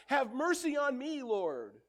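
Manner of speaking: a mother begging loudly for mercy; crying out. Acted. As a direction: cry out to him for help. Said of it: "she cried".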